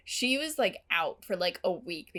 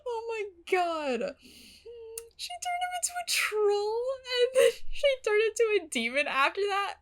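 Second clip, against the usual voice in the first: much higher, laughing through each word; 11 semitones higher than usual. Real, not acted. Vlogger laughing about Anya's troll ex, high and giddy. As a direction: giggle it out in disbelief.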